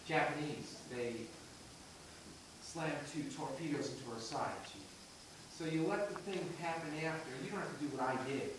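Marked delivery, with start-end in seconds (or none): 0.1-4.8 low, quiet, halting hero's account; covering the wound. Shown as a demonstration. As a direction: play down the pain, soft and low with pauses. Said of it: "you're trying to cover it because you're a hero".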